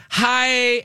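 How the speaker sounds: high, sing-song "Hi."; mock bride greeting. Bride greeting the bartender, high and sing-song. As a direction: chirp it like the bride. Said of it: "She goes".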